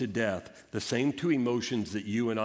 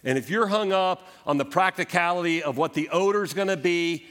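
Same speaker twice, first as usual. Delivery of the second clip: high, loud urgent preaching; fervent exhortation; 7 semitones higher, 4 dB louder than usual. Acted. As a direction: press the promise home with fervor. Impassioned exhortation to congregation.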